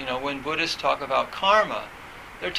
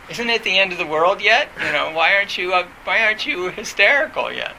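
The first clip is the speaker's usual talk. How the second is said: higher and a bit louder, teasing disbelief; mock incredulity; 4 semitones higher, 4 dB louder than usual. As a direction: tease a calm family for not screaming. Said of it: "So I, it was like".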